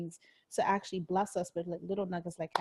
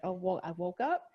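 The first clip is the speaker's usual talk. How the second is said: upbeat morning resolve; 6 dB louder than usual. Retold or imitated.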